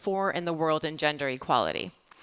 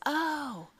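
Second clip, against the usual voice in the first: higher, slow "oh, they're mating"; dawning realisation; 8 semitones higher, much slower than usual. Retold or imitated. Speaker realising the dragonflies are mating, slow surprise. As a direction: let the penny drop slowly. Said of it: "And I realize".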